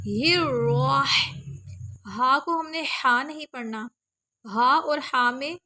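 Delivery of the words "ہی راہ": slow ہی راہ, breathy ha flowing into ra; joining ha to ra. Shown as a demonstration. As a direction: hold ہی and glide into راہ. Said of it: "ہا کی آواز کو ہم نے را کے ساتھ ملانا ہے". (ہی کو راہ کے ساتھ ملا کر)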